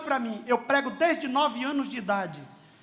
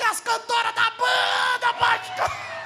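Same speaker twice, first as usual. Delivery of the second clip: imitated crying boy; 10 semitones higher, slower than usual.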